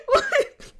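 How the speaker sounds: very high squealing laugh into a disbelieving what; incredulous laugh. Real, not acted. To viewers, amazed an anime made her cry, high laughing what.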